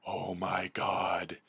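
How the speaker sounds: drawn-out, stunned exclamation; voiced, deliberate shock at half speed; slow disbelief. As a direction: stretch each word in dismay. Animals shocked by buffalo's defeat.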